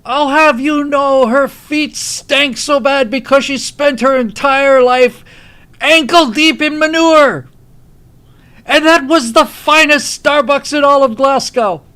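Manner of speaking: slow, high, full-volume Scottish rant; loud, drawn-out outrage in a Scottish accent; enraged Groundskeeper Willie. Retold or imitated. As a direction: roar every word in indignant Scottish fury. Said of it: "He just gets completely enraged".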